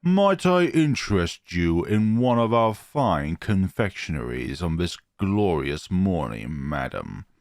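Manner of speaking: mock-formal posh waiter; posh accent. Playful narrator poses as a fancy waiter to surprise Gidget, posh accent. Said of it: "in my best posh accent".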